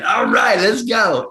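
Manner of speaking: high, breezy put-on Mickey voice; cocky high-pitched buddy call; Mickey's greeting. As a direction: tease your partner like Mickey would. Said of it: "he'd always say".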